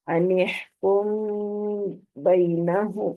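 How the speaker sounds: slowly chanted "अनिहकुम" with a hummed meem; two-beat nasal hold. Shown as a demonstration. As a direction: stretch the meem of "अनिहकुम", then release "बैनहुम". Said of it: "दो हरकत जितना पूरा करना है".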